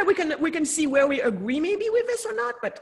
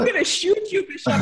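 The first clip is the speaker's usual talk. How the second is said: playful fake threat, louder and higher; joking threat to a friend; mock threat; 7 semitones higher, 3 dB louder than usual. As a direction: tease him with a pretend menace. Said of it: "I'm just kidding".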